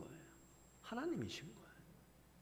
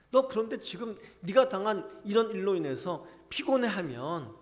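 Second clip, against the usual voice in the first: loud, higher stern rebuke from God; God loudly pressing Jeremiah to face worse trials; God's stern challenge; 6 semitones higher, 10 dB louder than usual. Retold or imitated. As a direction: challenge the prophet at full voice, rising higher. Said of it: "하나님 지금 그렇게 말씀하고 계시는 거예요".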